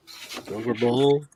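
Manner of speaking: ordinary talk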